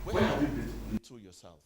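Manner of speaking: loud probing cry to the crowd; booming challenge. Acted. Preacher calling out to his listeners, booming. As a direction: call it out full voice.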